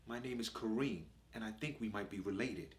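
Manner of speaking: put-on quiet, soft polite boy; well-spoken kid, hushed and careful; little posh voice. To Nigel, a quiet posh boy introducing himself. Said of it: "He's got this little posh voice".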